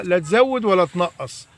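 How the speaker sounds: ordinary talk, nothing marked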